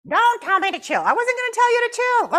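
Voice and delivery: high, loud mock squabble; hammed-up puppet spat. Puppet Jasmine and the counselor bicker in loud high voices.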